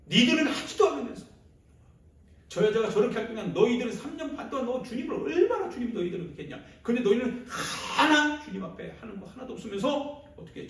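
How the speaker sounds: loud, high-pitched scolding rant; acted outraged dressing-down; angry tirade. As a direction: lay into them with rising indignation. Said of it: "막 화가 나요".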